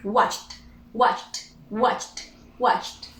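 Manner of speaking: "watched" as one syllable ending CH-T; final T sound. Shown as a demonstration. Teacher drilling the difficult one for learners, final T sound. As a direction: release a T straight after the CH in "watched". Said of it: "we pronounce only T".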